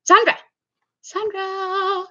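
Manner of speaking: high, sing-song call of the name; calling Sandra in a high pet voice; calling the cat. Real, not acted.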